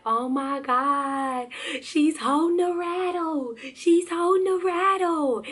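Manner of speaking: high, drawn-out squeal of an overexcited parent; gushing parent. Acted. Parent marvelling at baby holding a rattle, high gushing squeal. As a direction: gush slowly in a high, doting squeal. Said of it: "one of those parents who are like".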